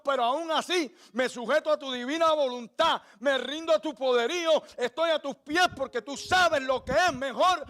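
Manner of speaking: full-force pulpit preaching. Acted. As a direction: preach it out, pleading for surrender.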